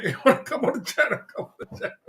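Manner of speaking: laughing through the taunt. Real, not acted. Laughing while teasing a viewer to join. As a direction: giggle the words out as you tease.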